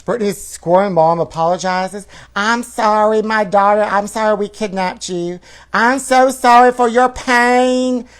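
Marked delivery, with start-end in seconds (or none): 2.3-8.1 high, louder whiny mom; whiny apology. Acted. Mother whining her apology to her daughter on Instagram. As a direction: whine the apology like a scorned mom. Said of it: "whined Lynn on Instagram".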